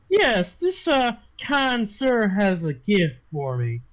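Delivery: high, drawn-out madam's voice; brothel madam. Acted. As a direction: start high and slow as the put-upon owner. Game master voices madam answering bouncer.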